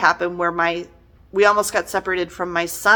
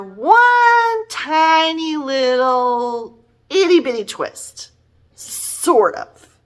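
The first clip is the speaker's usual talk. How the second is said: mocking small; 7 semitones higher, much slower than usual. Real, not acted. Belittling the tiny twist.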